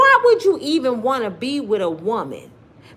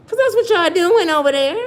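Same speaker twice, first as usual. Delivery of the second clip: high, loud call-out; loud accusing jab; 9 semitones higher, 6 dB louder than usual. Real, not acted. Scolding passport bros for tricking, loud and high. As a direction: call them out sharply.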